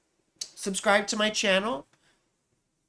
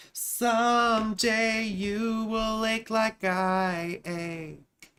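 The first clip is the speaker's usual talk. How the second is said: slow, higher, theatrical lovesick voice; dramatic recital; 5 semitones higher, much slower than usual. Pretend. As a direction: ham it up like a tragic poet. Dramatic line recited mid reading.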